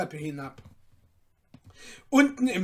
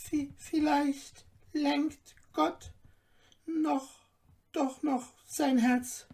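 wife's timid hope; 9 semitones higher, slower than usual. Acted. Cutler's wife chiming in timidly, halting. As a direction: venture it hesitantly. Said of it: "redete das Weib drein".

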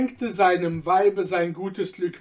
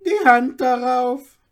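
much higher and louder handshake promise; the tailor seals the deal eagerly; old tailor agreeing; 6 semitones higher, 8 dB louder than usual. A narrator's reading. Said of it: "erwiderte Babadul". (alter Schneider, viel höher und lauter)

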